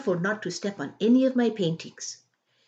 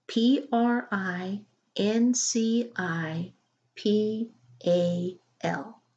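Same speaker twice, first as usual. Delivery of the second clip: spelling slowly; 3 semitones higher, slower than usual. Acted. Julia spelling word out.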